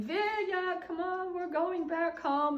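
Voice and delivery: high calling voice across the hill. Retold or imitated. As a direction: call her home from afar. Calling child home at dusk.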